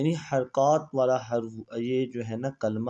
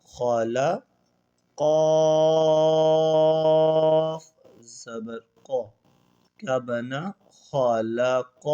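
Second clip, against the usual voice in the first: Quranic recitation drill; much slower than usual. Shown as a demonstration. Teacher chanting the lesson's letters for students, drawn out. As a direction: intone each letter slowly like a recitation.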